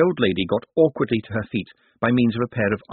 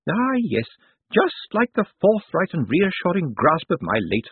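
put-on high, admiring old woman; feisty old lady; 7 semitones higher than usual. A narrator's reading. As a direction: address a rogue with relish as an elderly lady. Old lady after laughing.

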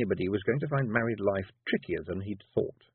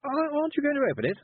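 put-on nosy old lady. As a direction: burst out with curiosity. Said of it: "shifting and clearing her throat".

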